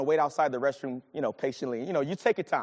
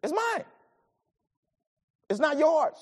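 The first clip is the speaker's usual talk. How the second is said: God's claim; 9 semitones higher than usual. Acted. Voicing God's claim to vengeance.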